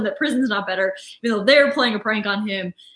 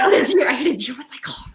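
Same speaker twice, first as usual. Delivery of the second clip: panicked Michael impression; faster than usual. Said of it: "quoting Michael".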